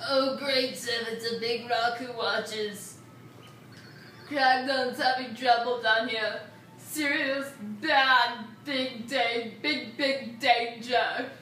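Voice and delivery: lightly voiced dim caveman; slow, simple brute talk. Voicing a Kragnon character.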